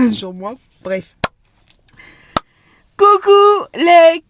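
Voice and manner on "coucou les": squeaky bright hello; high cheery greeting. Real, not acted.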